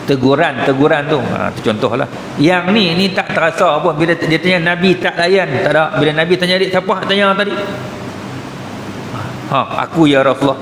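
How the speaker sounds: ordinary talk, nothing marked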